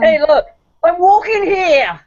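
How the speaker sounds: put-on New York accent. Joking about practicing accents, in New York accent. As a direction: lean into a movie New Yorker accent. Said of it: "practicing your accent".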